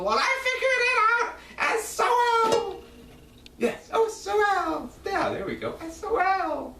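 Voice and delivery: very high-pitched, exultant cry; high, jubilant eureka voice; triumphant outburst. Real, not acted. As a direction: crow it way up high, delighted to have cracked it. Exultant at working out the name.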